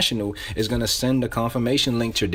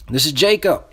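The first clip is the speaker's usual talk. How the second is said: higher, insistent and familiar; urgent, pitched-up insider; close-friend caller; 7 semitones higher than usual. Shown as a demonstration. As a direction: press them like an old friend being screened. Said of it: "You're calling like your close friend".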